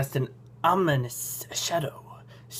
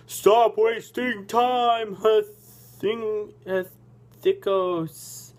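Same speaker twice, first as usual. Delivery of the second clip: raised, slow, drawn-out father's command; gruff booming voice; 6 semitones higher, much slower than usual. A narrator's reading. Father calling from the guard's platform, gruff and booming. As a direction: boom it out slowly, pitched up. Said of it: "A gruff voice booms above you".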